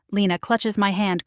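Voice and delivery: ordinary delivery, nothing marked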